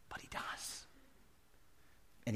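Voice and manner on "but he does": soft whisper; very soft, voiceless reply; hushed assurance. Real, not acted. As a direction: whisper it as a quiet answer to doubt. Hushed answer to his own doubt.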